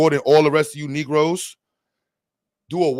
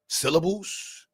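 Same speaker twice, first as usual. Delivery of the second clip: ordinary delivery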